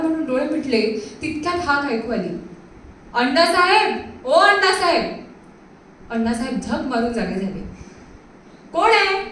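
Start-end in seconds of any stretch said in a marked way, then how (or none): 3.1-5.0 loud call from the street, higher; hollering up at the house from outside. Acted. Neighbour calling from outside. 8.7-9.2 calling back. Acted. Woken, calls back to caller.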